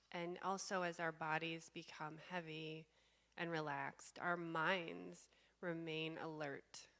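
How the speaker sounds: slow, calm meditation guide with long pauses; guided meditation. Real, not acted. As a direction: guide them slowly into stillness, leaving space between phrases.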